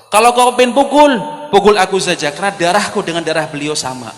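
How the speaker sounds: loud, high-pitched challenge; impassioned defence. Acted. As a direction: offer yourself forcefully in his place. Acted companion defends the Prophet. (tantangan lantang membela Nabi)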